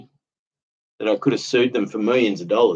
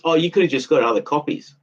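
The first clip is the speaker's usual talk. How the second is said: mock dismissive; 5 semitones higher, faster than usual. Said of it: "he goes".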